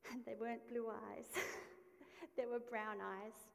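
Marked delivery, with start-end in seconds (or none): 1.4-2.3 a short airy laugh, pitched up; quick breathy laugh. Real, not acted. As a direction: chuckle at the irony. Laughs at the brown eyes.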